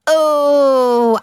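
long, loud, high swooning oh; hammed-up drawn-out reaction; theatrical oh. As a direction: draw it out big and high. Theatrical reaction to listener Anthony.

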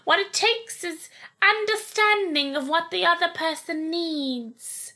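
slow, high mock royal voice; drawn-out, put-on royal lecture; the Queen. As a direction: explain it ponderously as a regal old monarch. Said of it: "I'm really good at doing the Queen".

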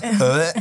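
high, repeated "eh" of distaste; mock disgusted grunts. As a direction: act out recoiling from the food. Acts disgust at her food bowl.

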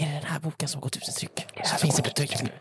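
urgent whispered question. Acted. Acted, to Viktor beside him on live TV, whispering. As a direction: lean in and whisper it in alarm. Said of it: "och så viskar du så här".

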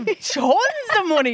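slow, high, mock-grand boast; sarcastic boast. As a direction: brag it ironically, dragging it out. Boast undone by empty bank account.

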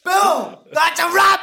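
loud, high-pitched triumphant yell; shouted sign-off. Pretend. Guest wrapping up the podcast for laughs, shouted sign-off. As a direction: holler it like a big show-ender.

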